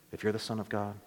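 a sly, hushed tempting whisper-like line; devil's quiet taunt. Retold or imitated. The devil tempting Jesus, soft and low. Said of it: "Remember the devil saying that?".

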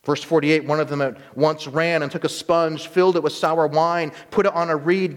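ordinary delivery

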